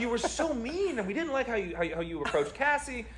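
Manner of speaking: high, soft mocking imitation of the friends. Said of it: "They're like".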